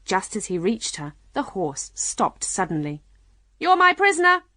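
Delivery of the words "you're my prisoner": high, loud knight's shout; loud cry. A narrator's reading. Red Knight claiming Alice as captive, shouting. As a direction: shout it out like a challenge. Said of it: "the Red Knight cried".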